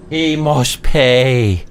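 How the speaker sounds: dramatic vengeance vow. Pretend. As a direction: stretch it out like a grim revenge oath. Catchphrase repeated while gaming.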